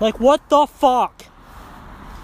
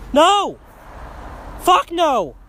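angry refusal; 8 semitones higher, much slower than usual. Real, not acted. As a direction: shout the refusal in hard separate bursts. Rejects a double standard angrily.

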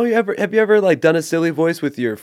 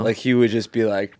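ordinary talk

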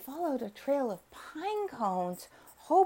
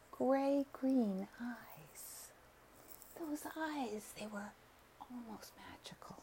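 soft, slow, fading to a whisper; hushed wonder; 7 dB quieter than usual. A narrator's reading. Narrator whispers about the dog's magical eyes. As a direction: breathe it out in awe.

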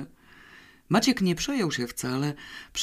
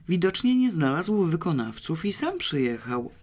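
plain reading voice

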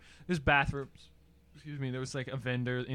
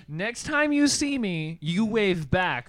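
slow, higher, emphatic telling-off; mock scolding; 5 semitones higher, 5 dB louder, slower than usual. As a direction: imitate a woman laying down the law word by word. Acting out the woman scolding him.

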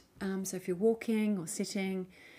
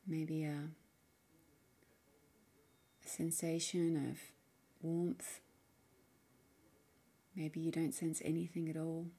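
soft, unhurried meditation voice with long pauses; guided meditation; 4 dB quieter than usual. Acted. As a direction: gently guide the listener inward, leaving space between phrases. Leads a guided mindfulness practice.

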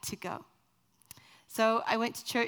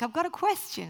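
put-on higher-pitched eager child; eager kid; 7 semitones higher than usual. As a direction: pipe up like a kid in class. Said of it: "And the kids are like".

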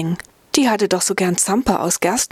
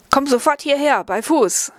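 sharp, higher command to the dog; scolding the dog; 7 semitones higher than usual. Acted. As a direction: bark out the order to heel. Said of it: "schalt sie das Tier".

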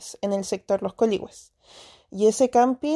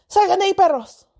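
higher, louder yell at the dogs; shouting at dogs; 8 semitones higher, 4 dB louder than usual. Retold or imitated. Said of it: "y al tiro grité". (grito para espantar a los perros)

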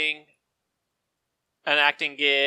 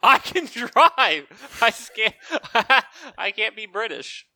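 high, slow words broken up by laughter; real laughing protest; 3 semitones higher, slower than usual. Real, not acted. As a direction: protest while cracking up.

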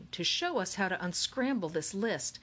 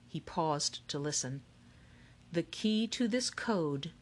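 slow, measured riddle recital with pauses; reciting a riddle. A narrator's reading. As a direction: deliver it like a mysterious verse. Lemoncello recites riddle slowly.

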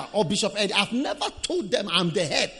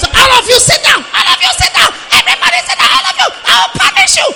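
shouting headmistress, much higher and louder; stern headmistress voice; 13 dB louder, faster than usual. Retold or imitated. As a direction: bark orders at a noisy class. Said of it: "a voice of a headmistress".

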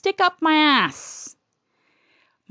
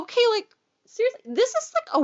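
exasperated; 9 semitones higher, faster than usual. Retold or imitated. Inner protest during exam.

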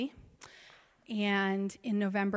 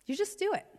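You just do it flung out much higher, lightly; breezy high toss-off; 10 semitones higher, faster than usual. Retold or imitated. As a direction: brush off the question with a high, airy line. Retold advice to an overwhelmed student.

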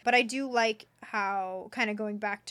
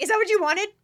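high, loud mock questioning; exaggerated, laughing re-enactment; mock indignation; 10 semitones higher, 9 dB louder, faster than usual. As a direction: replay it with hammed-up outrage. Mock replay of the exchange.

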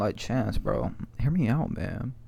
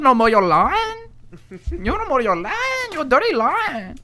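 high, loud broken-English taunt; mock foreign accent; 13 semitones higher, 6 dB louder than usual. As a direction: squeal the insults in a put-on accent. Mocking accent insult bit.

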